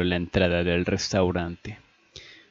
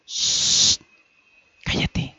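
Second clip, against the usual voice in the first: sharp shush, then whispered order; voiceless hushing; much slower than usual. Acted. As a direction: whisper so the caller can't hear. Hushing Annie during the phone call.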